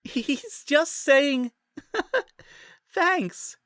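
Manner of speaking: high, slow giggly words; laughing. A narrator's reading. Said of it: "squealed in between bouts of laughter".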